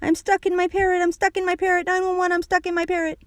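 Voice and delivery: put-on high, frantic child's cry; high-pitched alarm without full volume; panicked shout. A narrator's reading. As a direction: repeat it in a kid's panic. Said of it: "I panicked and shouted".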